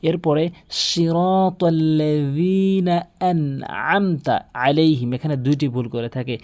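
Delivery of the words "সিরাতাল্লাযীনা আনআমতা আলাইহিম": slow, drawn-out recitation with pressed ains; Quran recitation. Shown as a demonstration. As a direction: recite the verse with long vowels and heavy consonants. Recites the verse as demonstration. (ধীরে টেনে তেলাওয়াত)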